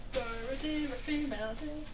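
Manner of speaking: usual speaking voice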